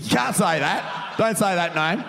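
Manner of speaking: high, slow mock scolding; teasing mock scold; mock warning. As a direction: play the fun police. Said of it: "microphone police tonight".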